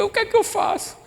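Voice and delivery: high, choked voice near tears; crying down the phone to his wife; tearful phone call. Retold or imitated. Husband calling home, voice breaking with tears. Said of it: "eu liguei chorando".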